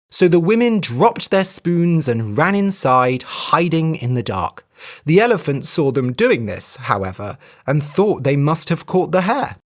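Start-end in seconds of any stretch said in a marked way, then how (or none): none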